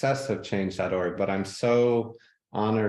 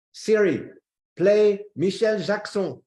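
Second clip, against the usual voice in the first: put-on French accent; 9 semitones higher, 5 dB louder than usual. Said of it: "a Frenchman, [name]".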